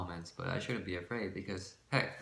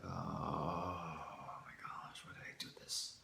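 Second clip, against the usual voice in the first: whispered, hushed self-reproach; dismayed whisper; 3 semitones lower, 10 dB quieter than usual. Retold or imitated. Cringing at her own recording, whispering in dismay.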